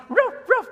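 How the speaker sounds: loud, high double bark; sharp high-pitched ruffs; imitated dog bark. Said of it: "every time he barks".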